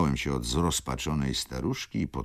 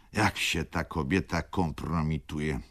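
usual speaking voice

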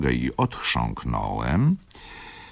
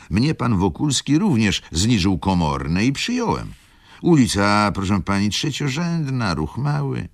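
higher, louder chatty steward; steward chatting; 8 semitones higher, 4 dB louder than usual. Acted. Steward reassuring the lady about the street, higher-pitched. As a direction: talk it up like a friendly steward.

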